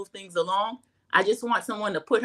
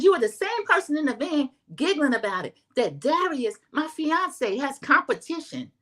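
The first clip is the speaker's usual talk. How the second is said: raised, high-pitched mock outburst; mock heated accusation, pitched up; retold yelling; 6 semitones higher than usual. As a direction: imitate a castmate arguing at high pitch. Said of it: "Jassy is yelling".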